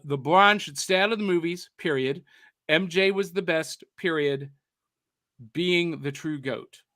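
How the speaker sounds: "period" said flatly as a spoken full stop; reading the periods aloud. Shown as a demonstration. Host rereading his brother's comment with the periods spoken aloud. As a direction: land each "period" like a hard full stop. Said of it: "there should have been a period there".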